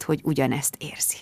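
hushed whisper. A narrator's reading. Narrator whispers Mark's confession of love. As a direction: breathe it almost silently.

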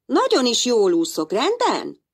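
magasabb gyerekhang, sértett tiltakozás; dühös tiltakozó kisfiú. Acted. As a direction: dacosan vágj vissza az apádnak. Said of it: "tiltakozott dühösen Némó". (Némó dühösen tiltakozik az aggódó apja ellen)

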